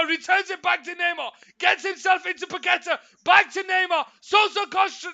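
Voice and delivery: shouting live play-by-play; excited goal call. Real, not acted. Goal commentary at full force.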